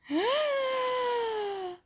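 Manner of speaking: sharp high gasp of wonder; a hammed-up gasp for the kids; big gasp. Reveal of the giant letter.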